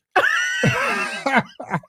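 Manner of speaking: high, helpless burst of laughter; real wild laugh; shrieking laugh. Real, not acted. Laughing at his own story.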